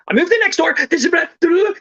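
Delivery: very high, garbled mock rapid-fire; talking too fast. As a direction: gabble it into nonsense, imitating a too-fast talker. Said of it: "I talk too fast and I'm Irish".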